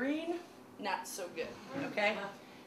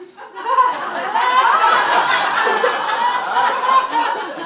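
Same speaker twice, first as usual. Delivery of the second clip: loud, high burst of laughter; big laugh; 11 semitones higher, 12 dB louder than usual. Real, not acted. Comedian cracking up at the dad's line. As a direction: crack up loudly. Audience roar after punchline.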